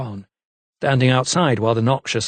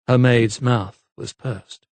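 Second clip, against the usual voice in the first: ordinary delivery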